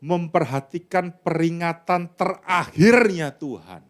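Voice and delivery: slow, word by word; slow group recitation; slow together reading. Shown as a demonstration. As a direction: read it slowly with everyone. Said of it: "Yang keras".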